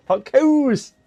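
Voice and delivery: high, loud, exaggerated Irish accent. Shown as a demonstration. Streamer showing off for chat, hammed-up Irish accent. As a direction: push the Irish accent right up and out. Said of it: "I can do an Irish accent".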